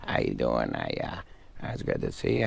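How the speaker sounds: lightly voiced mumbling comic, trailing off quietly; mumbling Adam Sandler. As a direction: mumble it like a shy comic greeting a host. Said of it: "the one part where he just kinda mumbles".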